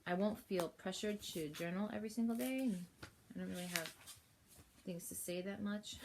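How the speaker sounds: ordinary delivery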